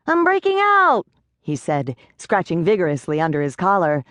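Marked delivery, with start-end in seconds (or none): 0.1-1.1 high, frantic little pixie; a tiny panicked pixie, much higher. A narrator's reading. Pixie panicking to Rachel about his clothes, high and frantic.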